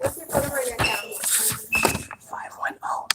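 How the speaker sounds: hushed whisper of numbers; whispering on the phone. Real, not acted. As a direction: whisper the digits so no one hears. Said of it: "Why are you whispering that?".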